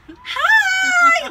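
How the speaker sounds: very high, loud chirpy hi; fake cheery hi. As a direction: sell a bright hello to a stranger. Forced cheery phone greeting.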